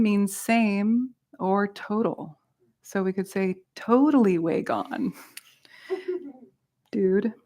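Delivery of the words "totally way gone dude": lightly mocked surfer slang; a hint of laid-back surfer dude; Southern California surfer. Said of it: "like a Southern California surfer".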